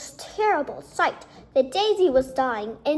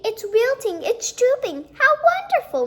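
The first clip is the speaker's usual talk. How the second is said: rising high, louder joy; overjoyed the flower is dying; delighted; 10 semitones higher, 5 dB louder than usual. A narrator's reading. Principal rejoices the daisy wilts, high and excited.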